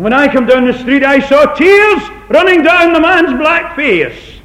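high, loud, slow preaching cry; rising sermon climax; impassioned preaching. Acted. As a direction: preach it out at full force. Preacher at full force.

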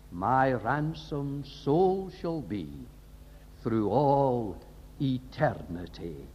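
slow, drawn-out devotional intoning; lingering over each line of the hymn; hymn recitation. Acted. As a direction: reverent hymn verse, very slow.

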